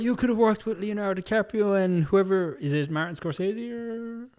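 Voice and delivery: high-pitched imitation of complainers; mocking critics. Podcaster quoting fans scolding an actress's choice, mock complaint.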